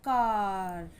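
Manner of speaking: "कार" with a very drawn-out aah, falling; sustained vowel in "कार"; long A sound. Shown as a demonstration. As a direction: stretch "कार" slowly for learners. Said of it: "long sound आ रहा है आ का".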